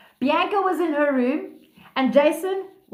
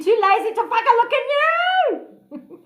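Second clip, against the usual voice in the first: high squawking chicken, climbing and held; 11 semitones higher, 4 dB louder than usual. Pretend. Teasing listeners for not looking inside, chicken voice. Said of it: "That's my chicken voice".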